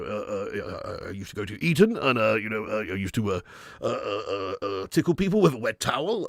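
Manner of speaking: low, bumbling, stammering politician; Boris Johnson. Acted. Former Prime Minister introducing himself, bumbling stammer. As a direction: hesitate and bluster, piling up the uhs. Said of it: "Boris Johnson".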